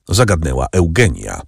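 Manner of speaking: plain reading voice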